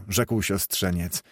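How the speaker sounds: usual speaking voice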